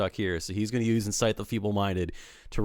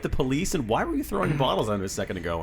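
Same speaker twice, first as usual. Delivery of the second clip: confused crowd; 6 semitones higher than usual. Acted. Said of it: "Everyone's just like".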